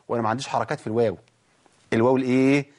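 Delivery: usual speaking voice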